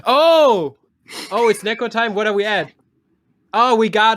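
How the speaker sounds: high, loud excited gasp; light up with delight. Real, not acted. Excited at Neko time donation.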